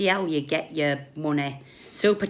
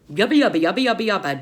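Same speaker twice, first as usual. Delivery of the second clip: mocking babble; 4 dB louder, faster than usual.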